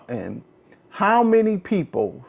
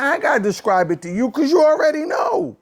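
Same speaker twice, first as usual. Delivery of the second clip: real frustrated yell, pitched up high; exasperated shouting at the audience; angry shout; 9 semitones higher than usual. Real, not acted. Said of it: "that's what makes me mad".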